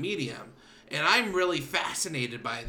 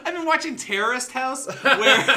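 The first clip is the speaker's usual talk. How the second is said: high, loud laughter through the words; laughing hard; 8 semitones higher, 8 dB louder than usual. Real, not acted. Friend caught mispronouncing the show's name, laughing hard. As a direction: crack up at the slip. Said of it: "Did you say Terraced House?".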